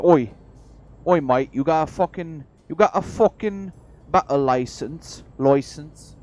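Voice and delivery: loud, high-pitched mock British bobby; hammed-up British copper challenging someone; horrible British accent. Bobby stopping a trainer over a battle license, horrible British accent. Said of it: "to do my horrible British accent".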